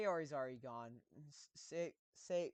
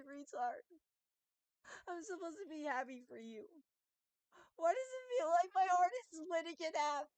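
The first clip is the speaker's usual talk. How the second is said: put-on high-pitched, hurting girl voice; tearful lovesick girl; 12 semitones higher than usual. Sayori confesses that everything hurts, tearful girl voice. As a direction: play her heartbroken, pitched well up.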